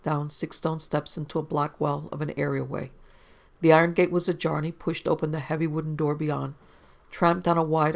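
ordinary delivery, nothing marked

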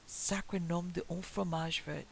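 usual speaking voice

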